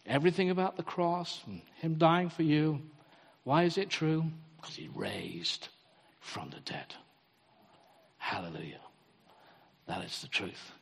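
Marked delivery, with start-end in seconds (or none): 4.6-10.7 hushed conviction. Real, not acted. Preaching resurrection, hushed emphasis.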